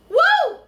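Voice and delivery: loud, high-pitched "woo"; emotional whoop. Real, not acted. Woman overcome while retelling her blessing, emotional whoop. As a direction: whoop to shake off rising emotion. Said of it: "I'm about to get emotional".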